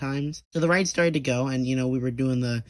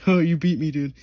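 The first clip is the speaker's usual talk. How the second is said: goofy guy voice; 2 semitones higher, 5 dB louder than usual. Retold or imitated. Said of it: "and he said".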